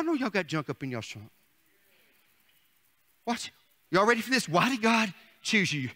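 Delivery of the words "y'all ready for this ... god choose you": ordinary talk, nothing marked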